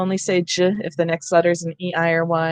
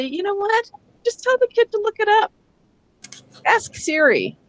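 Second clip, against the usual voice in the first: high, fed-up throwaway; exasperated; 12 semitones higher than usual. Real, not acted. Teacher fed up with spelling rules, exasperated and high. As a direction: give up on the rule with a sigh of frustration.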